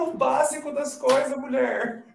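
high-pitched, laughing outrage; shocked, teasing exclamation; exasperated disbelief. Real, not acted. Friend doesn't know Castlevania games.

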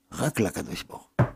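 sudden low, soft, quick reply; hushed answer. Real, not acted. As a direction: drop to a hush after the climax. Answer dropped after raised questions. (תשובה חרישית ונמוכה, מהירה)

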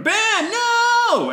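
high loud cry of alarm; shouted warning. Acted. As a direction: yell his name to stop him. Luke's shout in flashback.

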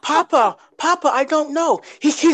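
put-on high, pleading little kid; scared child voice. Kid answering scolding father.

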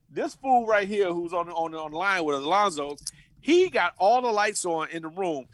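ordinary talk, nothing marked

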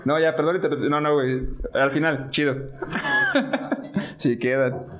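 stifling laughter between words; giggling, fighting back the laughter; trying not to laugh. Real, not acted. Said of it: "no me aguanté la risa".

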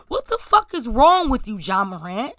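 normal talking voice

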